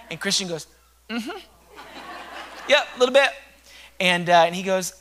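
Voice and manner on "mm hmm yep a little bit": imitating a friend's high, loud yep; high-pitched admission. Friend admitting to the officer they are nervous, high-pitched. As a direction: own up in a raised, squeaky-high voice.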